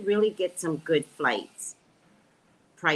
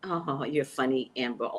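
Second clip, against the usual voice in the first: ordinary talk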